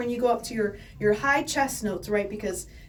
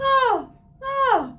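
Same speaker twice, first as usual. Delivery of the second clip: mixed voice; 13 semitones higher, 6 dB louder than usual. Shown as a demonstration. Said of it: "that's what they call mixed voice".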